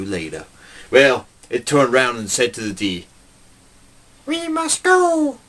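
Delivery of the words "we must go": very high, slow otherworldly unicorn; eerie high alien unicorn voice. Retold or imitated. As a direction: stretch it out like a creature from space. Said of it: "That's right, it spoke like that".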